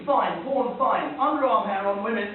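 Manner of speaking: ordinary delivery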